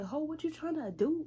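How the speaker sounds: high, loud threat; mock tough challenge. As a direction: stand up and call her out. Narrator standing up to her.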